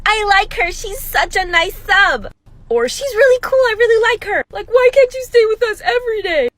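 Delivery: put-on high, loud little-kid voices; kids' voices. Kids in the hallway praising the substitute, high childlike gushing. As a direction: gush like happy children. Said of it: "kids would see me and be like".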